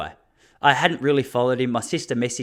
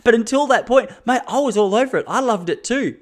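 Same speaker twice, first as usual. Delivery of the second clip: animated confession; 9 semitones higher, 4 dB louder than usual. Real, not acted. Confessing past habit.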